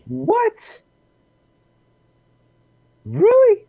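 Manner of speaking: very high-pitched shocked disbelief; incredulous outburst. Real, not acted. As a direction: squeak it out in astonishment. Reacts to shocking punch story.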